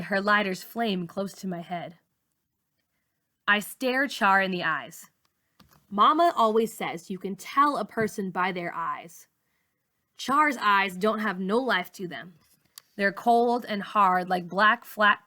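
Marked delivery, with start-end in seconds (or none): none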